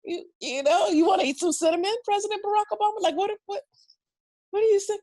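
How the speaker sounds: high, incredulous rant; baffled. Retold or imitated. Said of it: "Blows up".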